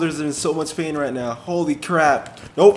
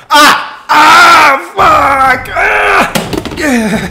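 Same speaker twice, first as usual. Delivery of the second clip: high, very loud cursing screams; real pain scream; 11 semitones higher, 10 dB louder, much slower than usual. Real, not acted. Player taking another shock, screaming in pain. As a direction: howl through the electric jolt.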